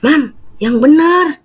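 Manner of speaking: high surprised hah, slowed; surprised disbelief. Retold or imitated. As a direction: sound stunned and doubtful. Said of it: "saya bilang gitu".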